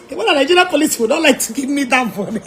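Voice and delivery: talking through laughter, fast; laughing. Real, not acted. Mocking the police on camera, laughing. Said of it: "laughing mockery".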